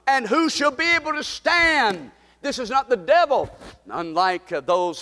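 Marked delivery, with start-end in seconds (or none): 0.1-3.5 high, loud, rising pulpit fervor; a preacher building to a roaring climax; preaching at full force. Acted. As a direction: thunder the verses at the congregation.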